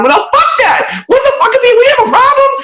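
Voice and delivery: furious retold protest, half laughing; heated high-pitched rant. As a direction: pitch jumps way up, indignant and fast. Retelling heated fight with sound guy.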